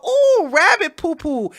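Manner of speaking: high, drawn-out ooh of wonder; mock delight. Imitating centrists who find rabbit droppings, mock delight. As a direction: gush over a silly find. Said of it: "they'll go".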